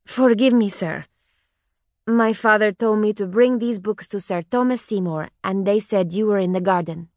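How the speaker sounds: a hint of a Spanish accent, slow and careful; a child with a light Spanish accent, answering politely; lightly voiced Spanish girl. A narrator's reading. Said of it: "very slowly with a strong Spanish accent".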